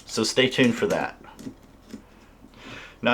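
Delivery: ordinary talk, nothing marked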